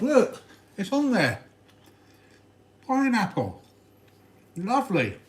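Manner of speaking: high, slow, triumphant tease; gleeful. Real, not acted. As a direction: gloat over the pineapple.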